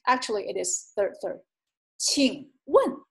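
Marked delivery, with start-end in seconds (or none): 2.0-3.0 请问 with dipping 请 and sharply falling 问; tone demonstration. Shown as a demonstration. Teacher showing learners third tone and fourth tone, careful demonstration. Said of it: "third tone and fourth tone".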